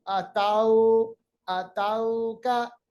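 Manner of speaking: अतौका slow, au drawn soft; soft drawn vowel. Shown as a demonstration. Teacher models अतौका the Arabic way. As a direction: stretch तौ gently. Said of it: "अतौका नहीं".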